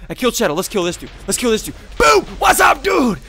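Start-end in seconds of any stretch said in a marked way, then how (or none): 2.0-3.2 high, loud taunting greeting; gleeful battle shout. Real, not acted. Gamer springing on an enemy player, gleeful shout. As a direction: pounce on them with a yell.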